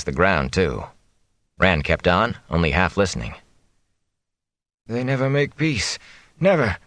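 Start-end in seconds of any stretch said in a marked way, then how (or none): 4.9-6.8 delirious, rising to an angry cry; feverish anger. A narrator's reading. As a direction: spit out NEVER in fevered anger. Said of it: "Though he sounded angry".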